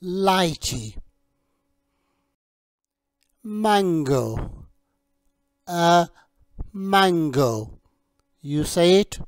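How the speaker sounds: slow, clear teacher voice modelling each word; teacher drilling a young English learner on fruit names; vocabulary drill. Shown as a demonstration. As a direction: model each fruit name for a child to repeat.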